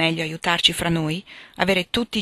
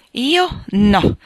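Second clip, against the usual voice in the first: louder, higher, emphatic refusal; prompt exclamation; 5 semitones higher, 6 dB louder than usual. A narrator's reading. Jo promptly answers her mother's question, exclaiming. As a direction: exclaim it readily and firmly. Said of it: "esclamò pronta Jo".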